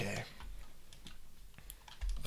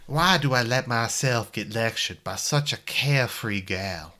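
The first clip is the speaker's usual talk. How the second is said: put-on Virginia accent. Game protagonist musing on a carefree girl, Virginia accent. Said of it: "Okay, I'll do my Virginia accent".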